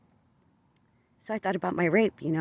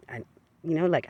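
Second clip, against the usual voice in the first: usual speaking voice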